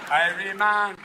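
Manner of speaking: laid-back Jamaican patois; easy Rasta Jamaican lilt; relaxed Jamaican Rasta drawl; Jamaican accent. Retold or imitated.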